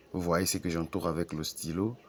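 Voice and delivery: ordinary delivery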